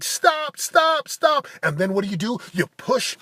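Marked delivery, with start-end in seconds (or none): 0.0-1.5 high, pleading cries of stop; voice of exhausted capacity. Retold or imitated. His body's limit begging him to quit, high pleading. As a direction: beg like your body wants to quit. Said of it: "your capacity is like".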